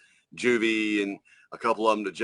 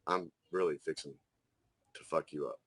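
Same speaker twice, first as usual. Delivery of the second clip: low, quiet menace, much softer; silent menacing glare; 7 semitones lower, 9 dB quieter than usual. Retold or imitated. Terrence glaring at the armed kid: low quiet menace. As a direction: let the threat sit low and soft, barely voiced out. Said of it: "Terrence is looking at that motherfucker like".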